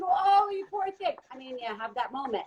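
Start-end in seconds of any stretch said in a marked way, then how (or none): 0.0-1.1 high, drawn-out imitation of sympathy; exaggerated slow poor-you sympathy; mock pity. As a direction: coo pityingly, as people do. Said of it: "But why do we do".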